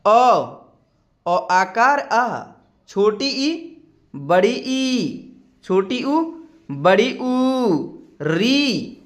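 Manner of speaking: each vowel drawn out slowly and clearly; slow vowel recitation. Shown as a demonstration. Teacher leads class in chanting vowels, slow and drawn out. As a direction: stretch every vowel for students to repeat. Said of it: "आप भी साथ साथ उच्चारण करिए".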